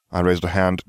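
usual speaking voice

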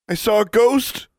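high, slow, frightened quiver. A narrator's reading. Boy running up from the basement, quivering. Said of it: "in a quivering voice".

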